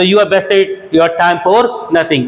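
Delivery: slow, deliberate teacher read; slow example sentence for learners; slow model sentence. Shown as a demonstration. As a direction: dictate each word slowly. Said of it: "तो".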